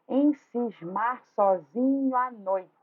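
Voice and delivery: slow syllable beats, "noite" cut short after "noi"; scanning the verse. Shown as a demonstration. Teacher scans the third stanza, syllable by syllable, ending "noite" on "noi". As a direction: beat out each syllable and stop "noite" at its stressed "noi". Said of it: "Escandir ou fazer escansão".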